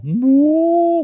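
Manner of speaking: loud, high, drawn-out howly moo; long moany howl. Retold or imitated. Imitating wolf-like elk, long loud moany howl. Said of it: "like a howly, long moany howl bugle".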